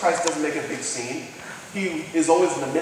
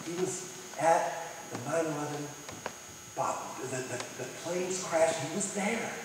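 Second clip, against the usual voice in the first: hushed; 3 semitones lower, 14 dB quieter than usual. Real, not acted. Pastor retells Ground Zero softly.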